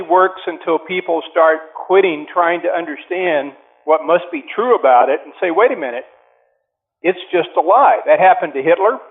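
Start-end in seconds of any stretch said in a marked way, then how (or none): none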